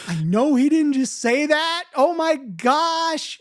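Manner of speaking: high, scandalised reaction; high-pitched retold disbelief, drawn out at the end; aghast disbelief. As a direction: relive being shocked, pitched well up.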